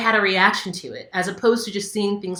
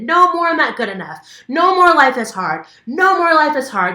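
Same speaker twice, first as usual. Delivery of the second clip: much louder and higher, raw yell; full release scream; 8 semitones higher, 9 dB louder than usual. Shown as a demonstration. Coach showing the bed-beating release, screaming loud and high. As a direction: let it all out while pounding the bed. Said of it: "beating the crap out of the bed".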